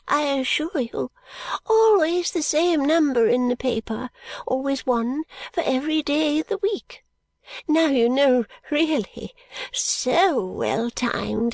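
put-on high, slow, eager old lady; an old woman sharing a wonder; fluttery old lady. Miss Flite tells of her shillings, high and eager.

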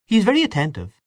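high, knowing archness; an arch comment, pitched well up. A narrator's reading. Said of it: "she said archly".

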